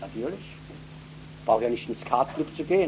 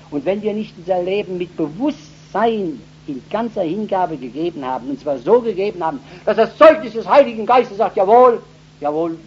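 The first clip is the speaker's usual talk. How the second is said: louder and higher, building to a cry; fiery preaching; 7 semitones higher, 11 dB louder than usual. Acted. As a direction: preach it with growing force to the crowd. Preaching at full force. (feurige Predigt, immer lauter und höher)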